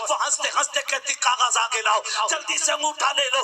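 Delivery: impassioned sermon building to loud peaks over music; full-force pulpit preaching about a mother's sacrifice; fiery preaching. Acted. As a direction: preach it hard to the young men. Preacher addresses youth about mothers.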